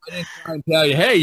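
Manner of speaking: normal talking voice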